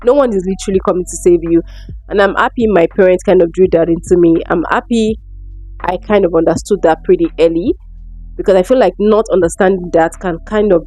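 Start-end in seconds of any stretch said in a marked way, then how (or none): none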